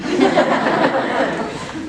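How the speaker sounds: a short burst of real laughter; amused laugh. Real, not acted. Cohost laughing at the sarcastic aside about those in power. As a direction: laugh out at the joke.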